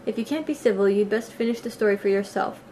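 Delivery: ordinary delivery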